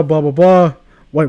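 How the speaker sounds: ordinary talk, nothing marked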